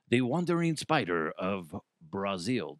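low, badly faked Brazilian accent; clumsy attempt at a Brazilian accent. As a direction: do a shoddy mock Brazilian accent. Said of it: "shitty attempt at a Brazilian accent".

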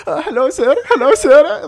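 high-pitched timid mock greeting; nervous employee. Nervous employee greets the VP who summoned him, timid and high. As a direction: imitate a terrified junior greeting the boss. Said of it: "who's so nervous".

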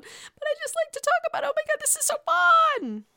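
high squealing gossip voice; gleeful squeal. Pretend. Vlogger squealing to viewers about the gossip, high and giddy.

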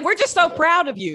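high, loud imitation of proud relatives; mock gushing pride. Host voices relatives proud of the author, gushing and laughing. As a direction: laugh through an exaggerated proud cheer.

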